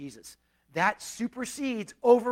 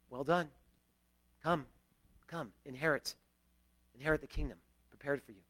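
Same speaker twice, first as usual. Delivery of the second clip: softer, tender voice of God welcoming home; gentle welcome; 5 semitones lower, 8 dB quieter than usual. Acted. God softly welcoming the redeemed into the kingdom, tender. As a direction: quietly invite them in, like a loving father.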